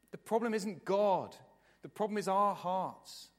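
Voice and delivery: ordinary talk, nothing marked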